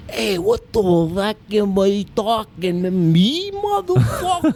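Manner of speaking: Italian wiseguy impression. Shown as a demonstration. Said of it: "Twenty Italians in one impression".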